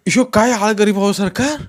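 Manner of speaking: high, loud plea from a poor man; protesting suspect. A narrator's reading. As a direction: protest the false charge in a high voice.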